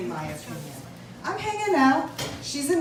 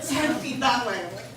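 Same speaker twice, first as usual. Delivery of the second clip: usual speaking voice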